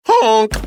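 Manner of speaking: loud, high imitated honk; goose honk. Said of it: "The goose cried out".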